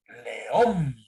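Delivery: "León" stretched, rising onto a strong "ón"; stress on the last syllable. Shown as a demonstration. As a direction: draw out "León", leaning on the second syllable. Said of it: "la fuerza de voz está en la última sílaba". ("León" alargado, con la fuerza en "ón")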